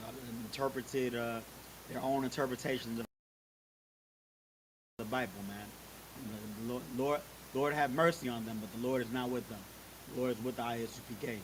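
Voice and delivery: ordinary delivery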